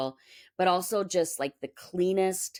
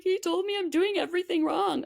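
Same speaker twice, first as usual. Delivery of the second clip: imitating a tearful kid, much higher; upset child; 13 semitones higher than usual. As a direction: voice her son through tears, high. Upset son reports teacher's words.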